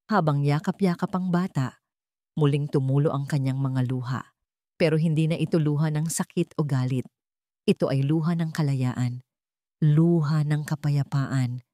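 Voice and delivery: ordinary talk, nothing marked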